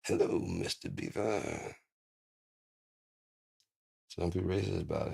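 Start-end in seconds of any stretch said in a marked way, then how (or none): 0.0-1.8 much lower, slow "Hello, Mister Beaver"; slow low creature. Pretend. Greeting the beaver, "Hello, Mister Beaver" much lower and slow. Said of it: "That's just how they talk".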